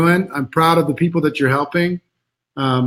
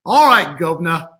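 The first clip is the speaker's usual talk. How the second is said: bad Cockney, "governor" said "guv-nuh"; hammed-up London Cockney; Cockney accent. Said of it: "his best English Cockney accent".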